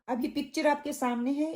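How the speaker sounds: normal talking voice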